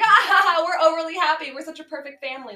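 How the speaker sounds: sarcastic impression of a gleeful perfect family; mock happy laughing. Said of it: "like, a lot of laughing".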